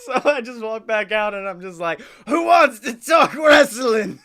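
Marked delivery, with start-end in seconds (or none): 2.3-4.2 high, slow, loud showman holler; hype-man shout. Acted. Speaker returns for a TED encore, hammed-up shout. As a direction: whoop up the crowd like a wrestling promoter. Hyped encore to imagined audience.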